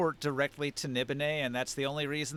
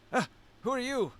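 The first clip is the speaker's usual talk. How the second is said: put-on high, startled old man voice; startled servant; 10 semitones higher, 3 dB louder than usual. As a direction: jump in pitch like a startled servant. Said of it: "he's going to say".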